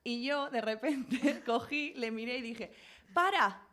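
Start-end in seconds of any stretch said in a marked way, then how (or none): 3.1-3.6 corte seco, agudo y fuerte; grito de alto. Retold or imitated. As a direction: suelta un alto repentino, subiendo el tono. Corta al chico en seco. (grito agudo para frenar en seco)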